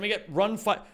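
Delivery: mock auctioneer chant, rapid and rising. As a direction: rattle it off like an auctioneer taking bids. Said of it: "gone into auction mode".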